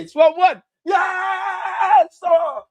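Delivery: yelling high and loud in joy; ecstatic goal shout. Real, not acted. Fan roaring as his team scores, ecstatic shout. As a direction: scream it as the goal goes in.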